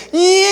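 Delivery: put-on a sudden high baby wail; baby's squeal. Baby brother woken by the pastor, high crying squeal. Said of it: "gritaba el bebé".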